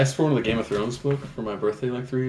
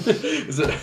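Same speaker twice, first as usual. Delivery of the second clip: laughing; 13 semitones higher than usual. Real, not acted. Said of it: "I sound like a drunken ogre".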